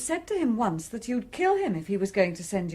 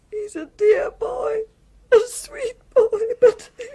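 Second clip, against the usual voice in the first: high, loud sobbing cry; a wailing, tearful outburst; 10 semitones higher, 7 dB louder than usual. Acted. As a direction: cry out as grief breaks through. Woman breaking down over the boy.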